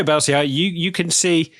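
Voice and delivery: ordinary talk, nothing marked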